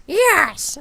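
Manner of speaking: high character voice; Spoons. Retold or imitated. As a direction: answer in a high cartoonish voice. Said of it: "And he's like".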